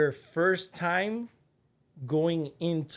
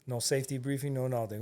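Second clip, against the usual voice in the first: ordinary talk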